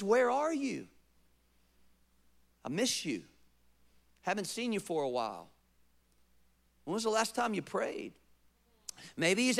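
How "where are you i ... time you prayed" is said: tender voice of God. Acted. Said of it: "Maybe his question is".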